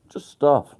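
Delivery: stuff said high and loud, falling. Shown as a demonstration.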